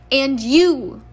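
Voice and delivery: loud, stern rebuke on two words; scolding mother. A narrator's reading. Mother rounding on her other son, scolding. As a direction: turn on him sharply and loudly.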